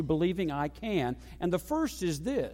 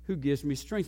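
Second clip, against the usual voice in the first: ordinary delivery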